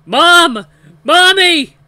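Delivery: very high, full scream for mother; instinctual cry. A narrator's reading. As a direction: scream out like a terrified child. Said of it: "the most instinctual cry comes".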